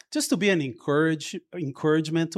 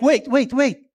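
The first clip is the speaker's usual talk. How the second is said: higher and louder, eager rapid questions; mock-eager wait wait wait; excited curiosity; 9 semitones higher, 7 dB louder than usual. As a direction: imitate Lori catching on excitedly. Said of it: "she's like".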